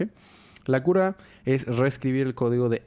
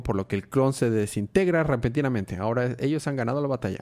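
ordinary delivery

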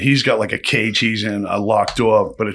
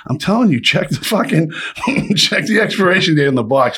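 mock insistence; 7 semitones higher than usual. Insisting lights are defective.

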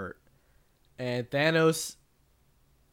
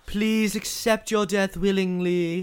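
higher, louder, slow mock villain decree; booming villain voice; 7 semitones higher, 4 dB louder, slower than usual. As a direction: announce it like a grand tyrant. Mock Thanos pronouncement.

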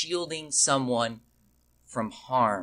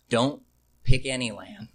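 usual speaking voice

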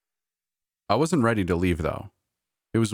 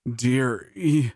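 choked gasp; 6 semitones higher than usual. A narrator's reading.

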